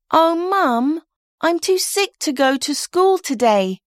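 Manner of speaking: put-on twelve-year-old faking illness; poor sick girl. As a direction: pity me, mum. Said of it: "says poor little twelve-year-old May".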